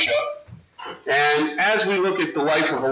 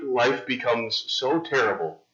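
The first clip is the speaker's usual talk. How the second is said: usual speaking voice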